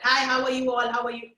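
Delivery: brisk greeting, words run together; quick, run-on greeting. Shown as a demonstration. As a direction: greet in an even run without separating the words. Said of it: "I didn't do this with the puppet".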